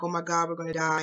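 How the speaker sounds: normal talking voice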